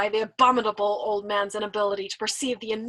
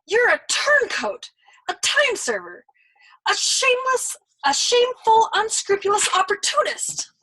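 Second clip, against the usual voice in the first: higher, louder, outraged accusation; outraged disbelief; 12 semitones higher, 7 dB louder than usual. Acted. Young man denouncing the old turncoat, loud outrage. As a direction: hurl the insults at the old man. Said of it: "Nately cried out in disbelief".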